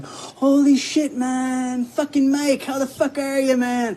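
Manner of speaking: high, slow mocking impression of friend's greeting; mocking imitation of friend. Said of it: "if I have to listen to you say".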